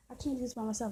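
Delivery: usual speaking voice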